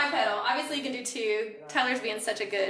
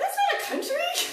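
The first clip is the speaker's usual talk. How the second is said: high, laughing disbelief; incredulous; 3 dB louder than usual. Real, not acted. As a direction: exclaim it in amused shock. Teasing wrong answer.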